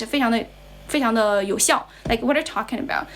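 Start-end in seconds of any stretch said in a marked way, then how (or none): 2.2-3.1 "What are you talking about" run together as one word; "What are you" reduced to "whaddaya", "talking" stressed; easy native-style linking. Shown as a demonstration. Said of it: "it's easier for them".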